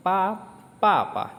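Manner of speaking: pa shot up high and loud, then papa falling; fourth tone papa. Shown as a demonstration. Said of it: "nada empat".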